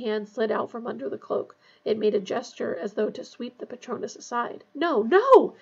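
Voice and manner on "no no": high, loud cry of alarm; gasped protest. A narrator's reading. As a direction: gasp it out, rising to a cry. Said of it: "Harry gasped".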